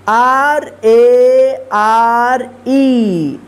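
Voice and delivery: letters spelled one by one, very slow; spelling out letters. Shown as a demonstration. Teacher spelling a word aloud slowly. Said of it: "rare".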